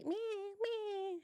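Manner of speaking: high, very soft squeaky mews; cat whine imitation. Voicing cat's little whines while showing her belly. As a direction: mew it tiny and high like a cat. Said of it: "you were like".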